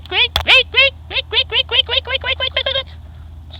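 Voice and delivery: very high squeaks, dying away at the end; piercing repeated squeaky noise; imitated squeaking rollers. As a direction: squeak like failing bearing rollers. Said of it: "the rollers were going squeak".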